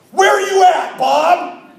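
high, full shout across a dance floor; loud call-out. Acted. DJ calling out crazy uncle in his chair, loud shout. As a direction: holler it at the top of your voice.

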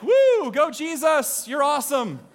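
high, loud, drawn-out whoop; playful rooting shout; mock fan cheer. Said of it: "It sounds like".

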